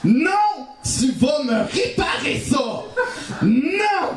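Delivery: high, emphatic telling-off; indignant scolding. Acted. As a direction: play a firm, hammed-up protest. Acted scolding of the creature.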